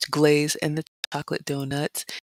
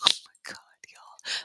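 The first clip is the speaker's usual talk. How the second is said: hushed, softer whisper at the buffet; awed whisper; 9 dB quieter than usual. Real, not acted. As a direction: whisper like you can't believe the spread. Awed whisper over food spread.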